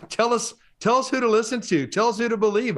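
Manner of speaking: high, eager and laughing, pressing for an answer; bubbling over with curiosity; eager excitement. Real, not acted. Host eagerly pressing the guest Avi, high and excited. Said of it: "I'm excited to hear".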